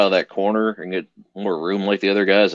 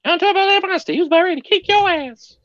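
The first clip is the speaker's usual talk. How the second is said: high silly voice; 3 dB louder than usual. Pretend. Silly voiced dog story.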